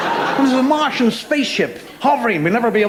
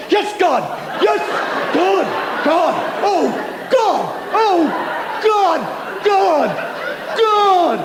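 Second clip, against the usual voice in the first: much higher, hammed-up "God!" cries; mock ecstatic cries; 10 semitones higher, much slower than usual. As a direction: call out God in fake ecstasy. Mocking orgasm cries.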